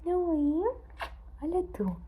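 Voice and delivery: high, loud coo to the cat; high pet talk. Acted. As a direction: squeak it at the pet. Cooing loudly to cat.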